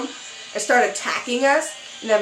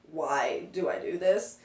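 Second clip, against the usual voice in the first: low, quiet self-pity; mumbled low, as if almost dead; weary aside; 4 semitones lower, 9 dB quieter than usual. Retold or imitated. Teller acting herself lying almost dead, weary and low.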